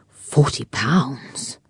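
breathless whispered disbelief; stunned whisper. A narrator's reading. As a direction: barely voice it, stunned.

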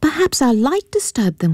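put-on high, girlish and hesitant; a young lady wondering aloud to her grandfather. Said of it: "She turns toward the older man".

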